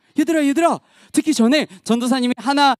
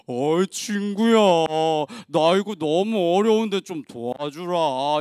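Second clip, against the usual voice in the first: low, slow pleading character voice; drawn-out, sagging request to a pal; friend begging help; 8 semitones lower, 3 dB quieter, slower than usual. Acted. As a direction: plead slowly in a put-on deep voice. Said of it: "한 친구한테 부탁을 했어요".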